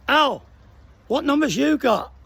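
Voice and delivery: shouted across room. Retold or imitated. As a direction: call out to a mate far across the hall. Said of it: "I shouted across the room".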